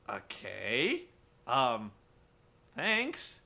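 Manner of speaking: halting, questioning politeness with rising ends; reply uncertainly to a creepy stranger, long pauses; weirded-out hesitation. Retold or imitated. Acting Angelina's awkward reply.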